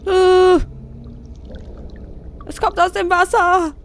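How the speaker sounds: high, loud startled cries; frightened. Real, not acted. Player seeing a corpse rise from the water, frightened yelp. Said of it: "ich hab heute etwas Schiss".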